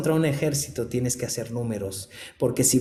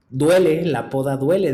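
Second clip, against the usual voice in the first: emphatic cry; 3 semitones higher, 7 dB louder than usual. Acted. Emphatic preaching.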